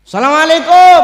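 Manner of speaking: high, booming greeting; acted loud call for attention; calling at a door. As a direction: shout it through a shut gate. Second call at hell's gate.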